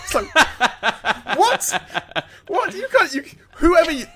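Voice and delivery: high, laughing protest; laughing disbelief. Real, not acted. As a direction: cry out through laughter in disbelief. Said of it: "laughing disbelief".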